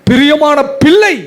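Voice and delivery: fervent cry. Acted. Climax of God's words.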